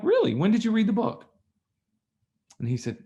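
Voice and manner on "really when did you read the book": much higher, louder disbelief; can't believe he only read it; incredulous really. Retold or imitated. Speaker questioning the first class passenger, incredulous. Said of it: "I'm like".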